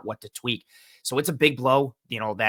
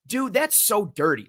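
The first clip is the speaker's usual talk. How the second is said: indignant disbelief; 9 semitones higher than usual. Retold or imitated. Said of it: "I was like".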